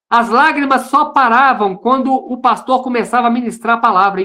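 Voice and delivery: usual speaking voice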